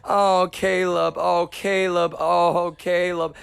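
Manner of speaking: high, slow breathy moaning of a name; hammed-up high moaning Caleb; imitating woman moaning. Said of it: "she's sitting there going".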